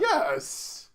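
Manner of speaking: high, emphatic exclamation; indignant yes. Real, not acted. Friend doubted about knowing Todd, indignant insistence. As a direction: insist sharply, pitch leaping up.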